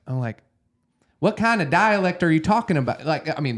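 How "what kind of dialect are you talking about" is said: hammed-up Southern accent, high and loud. Student answering acting coach, exaggerated Southern accent. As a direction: protest in a thick imitated Southern accent. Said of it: "that's literally Southern".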